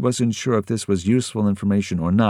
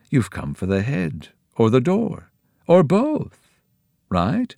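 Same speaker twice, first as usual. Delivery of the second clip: sly host, pitch climbing higher on each choice; knowing Pirandello; 8 semitones higher, 3 dB louder than usual. A narrator's reading. Pirandello guessing why the kids came, slyly. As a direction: tease them with what you know.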